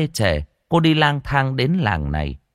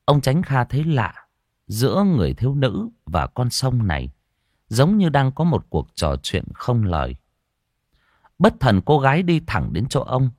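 ordinary delivery

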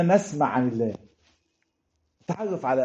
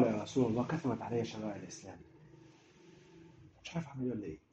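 overwhelmed hush; 4 semitones lower, 14 dB quieter, faster than usual. Retold or imitated. Voicing a lost, overwhelmed man.

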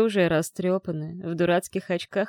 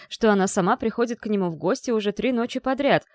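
plain reading voice

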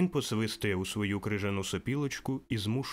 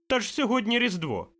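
put-on very high child voice; boy's voice; 12 semitones higher, 5 dB louder than usual. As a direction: play an amazed little boy. (хлопчик дивується, високим дитячим голосом)

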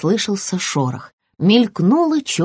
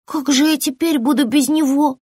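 tearful; 7 semitones higher than usual. Acted. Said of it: "заплакала ящерка".

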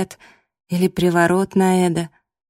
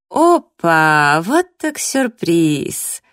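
theatrically surprised rival; mock surprise; 3 dB louder, much slower than usual. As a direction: relish the surprise, stretching it out.

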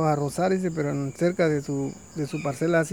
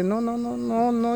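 higher, rapid string of no's; repeated refusal; 6 semitones higher, slower than usual. Acted. Vulture to farmer, refusing a second time, higher and insistent. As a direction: brush him off again.

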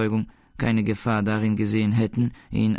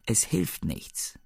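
softer and slow, a touch sad; calm, almost sad; 4 semitones higher, 5 dB quieter, much slower than usual. A narrator's reading. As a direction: say it quietly, almost sorry. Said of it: "ganz ruhig, ja fast traurig". (Wächter beruhigen K., leise, langsam und etwas traurig)

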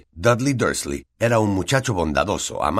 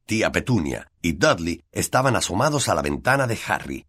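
usual speaking voice

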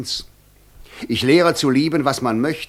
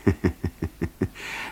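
soft low chuckle; 5 semitones lower, 6 dB quieter than usual. Acted. Devil laughs quietly.

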